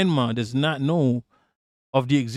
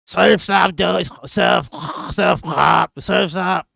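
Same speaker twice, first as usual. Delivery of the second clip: louder, higher surfer call in a mock Israeli accent; hammed-up Israeli accent beach shout; 4 semitones higher, 4 dB louder, slower than usual. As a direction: yell it across the waves in an Israeli accent. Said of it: "Israel accent".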